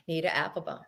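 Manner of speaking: quiet repeat. Pretend. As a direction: murmur the name again playfully. Repeats name in varied ways.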